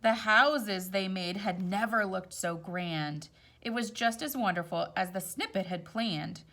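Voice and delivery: gentle storybook read-aloud; rhyming story voice; reading to children. A narrator's reading. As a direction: read it like a picture book at bedtime. Reads picture book aloud.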